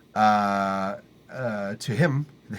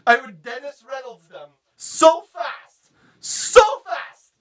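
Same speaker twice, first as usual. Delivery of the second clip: comic outrage; 10 semitones higher, 4 dB louder, slower than usual. Acted. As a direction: voice climbing to a high frantic yell. Threatens comic tirade, building.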